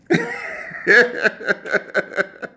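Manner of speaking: high wheezy giggle; breathless high laughing fit; real laughter. Real, not acted. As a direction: laugh on until the breath runs out. Cannot stop laughing at himself.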